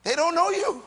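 loud, high, emphatic pulpit proclamation; full-force preaching. Real, not acted. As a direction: hammer each phrase home to the congregation.

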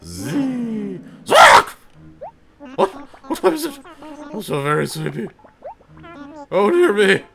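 put-on high, fussy old owl woken from sleep; flustered owl curator, high and loud; voiced sleepy owl. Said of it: "I keep doing the same voices".

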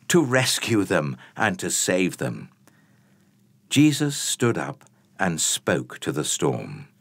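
ordinary talk

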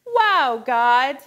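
excited exclaim. Shown as a demonstration. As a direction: open hands, call it out. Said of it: "open your hands and say".

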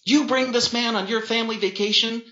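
high-pitched, furious accusation; spat aggressively. A narrator's reading. Sister attacking her cheating brother, aggressive. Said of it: "She spat in rapid-fire aggression".

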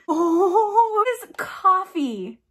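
high, laughing cry of dismay; laughing yelp. Real, not acted. Reacting to spilled coffee.

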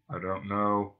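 depressed robot's dreary monotone; muffled drone. Acted. Marvin from face down in the dust, muffled drone.

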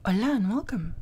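whispered. Real, not acted. Intro greeting.

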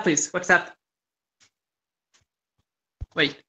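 normal talking voice

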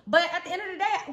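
usual speaking voice

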